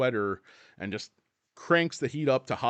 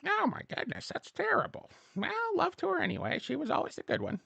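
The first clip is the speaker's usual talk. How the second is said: very high, softer mock-mother voice; mom voice; 4 dB quieter than usual. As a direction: coo it like a dotty mother.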